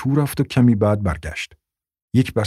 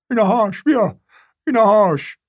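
put-on high, eager old man voice; 11 semitones higher than usual. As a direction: thrust it forward eagerly. Old man waves the paper.

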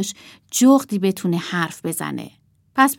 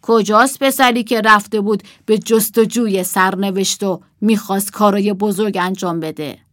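louder, commanding wizard; 6 dB louder than usual. Acted. Puppet wizard demands where the boy is, commanding. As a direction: boom out the wizard's question. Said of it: "جادوگر گفت".

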